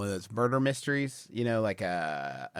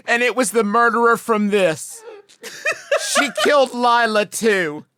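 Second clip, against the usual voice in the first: higher and louder, laughing through the quote; 12 semitones higher, 10 dB louder than usual. Real, not acted. Quoting daughter's dramatic news, laughing hard. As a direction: fight the laugh as you deliver it.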